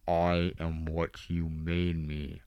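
slow, low, soft menacing Vader; quiet, dragged-out dark side voice; Vader impression. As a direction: intone it slowly like a cold Sith lord. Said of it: "Anakin goes, or Vader goes".

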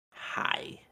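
whispered breathy alter-ego hi; a lightly voiced coy alter ego; changed voice. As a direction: girlfriend switching to her alter ego, whispering hi. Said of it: "I changed her voice".

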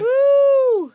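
high whooping cheer; excited cheer. Real, not acted. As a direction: cheer out in celebration.

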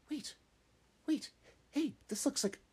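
sudden high alarmed voice; startled squeak. Acted. Little rabbit at the slope edge, high startled squeak.

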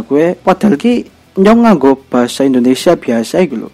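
ordinary talk

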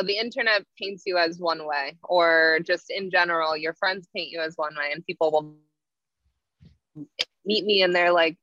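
ordinary delivery

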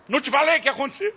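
mock stern father; rapid scolding. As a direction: rattle off the told-you-so. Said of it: "Dei sermão".

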